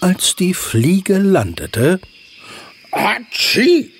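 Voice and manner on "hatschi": big sneeze; loud sneeze. A narrator's reading. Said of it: "nieste sich Mula wach".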